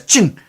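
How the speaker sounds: sharp "ching" of clashing weapons; imitated metal clash. Imitates spear parrying the sword.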